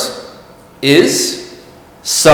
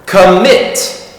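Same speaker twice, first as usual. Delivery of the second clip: "Commit" with MIT louder and higher; stress on second syllable; 4 semitones higher than usual. Shown as a demonstration. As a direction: punch the end of "Commit": com-MIT. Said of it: "It's louder".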